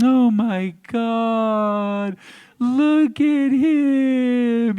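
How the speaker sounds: high, very drawn-out cooing; mock adoring gush, stretching every word; swooning ladies. Ladies in the audience seeing a cute creature, swooning coo. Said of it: "all the ladies are like".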